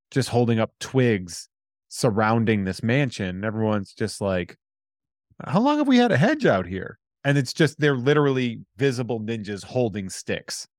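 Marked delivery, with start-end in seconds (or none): 5.4-7.0 high, much louder dopey guard voice; clueless guard. Retold or imitated. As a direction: squeak it out like a baffled guard. Ninja guard voiced in anecdote.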